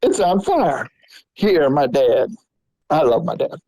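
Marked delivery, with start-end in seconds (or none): 0.0-0.9 mom realizing. Retold or imitated. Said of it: "my mom looked over and went".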